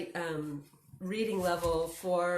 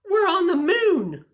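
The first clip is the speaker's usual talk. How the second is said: high, loud, thrilled declaration; storybook character crowing with amazement; excited announcement; 11 semitones higher, 9 dB louder than usual. A narrator's reading. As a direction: proclaim the big discovery. Character announces astonishing discovery.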